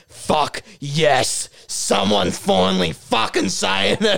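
an excited fan's relieved outburst; воодушевлённо. Acted. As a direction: draw it out, like finally being heard. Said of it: "people are hearing and being like".

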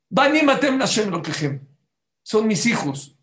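high-pitched proclamation of a verse; fervent preaching. Real, not acted. God claims the people as his children, fervent. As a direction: declare it with rising passion.

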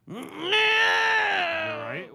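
high, slow wailing concern; over-the-top worried cry; hammed-up alarm. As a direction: wail it like a panicked parent. Fake concern, crying shout.

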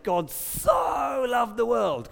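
"so" stretched long and pitched high, as in "sooo loved"; Chandler-style SO. Retold or imitated. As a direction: gush the word "so", drawn out and high. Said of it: "that's what Chandler did with that word so".

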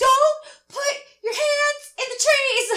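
high, drawn-out nervous scolding; a hammed-up anxious mom; frantic scolding. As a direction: plead with her to stop, half laughing. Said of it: "I'm like".

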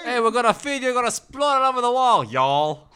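high, loud hammed-up Texan accent; mock Texan accent. Friend parodying the Texan-sounding waiter, loud Texan accent. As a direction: whoop it up as a fake Texan.

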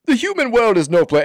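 put-on high, loud Jamaican-accented crab; Sebastian. Dad doing cartoon crab for daughter's play, Jamaican impression. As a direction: scold in a hammed-up Caribbean lilt. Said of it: "I do Sebastian very well".

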